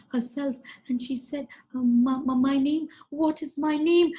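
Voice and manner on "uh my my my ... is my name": high, stammering little voice; flustered cockroach girl. Acted. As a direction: trip over the words, high and flustered. Meenakshi flustered, forgets her name.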